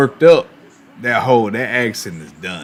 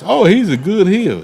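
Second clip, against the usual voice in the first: mocking fan quote; 10 semitones higher, 5 dB louder than usual. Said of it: "You know, some folks".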